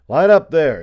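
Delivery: loud, high barked order; captain's command. A narrator's reading. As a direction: cut in, ordering the troops into line. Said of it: "interrupted the voice of Captain Pierce".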